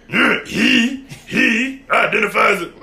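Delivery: high, loud imitation insisting on HE; hammed-up indignation; mock indignant correction. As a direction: mimic an outraged coworker, slow and high. Imitating the coworker's angry correction.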